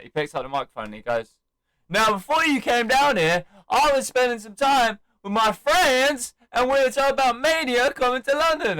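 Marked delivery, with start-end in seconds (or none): 1.9-8.8 high, loud speech to an arena; re-enacted shouting wrestler; imitated fired-up promo. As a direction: holler it on the mic to rally fans. Acts out Cena's promo.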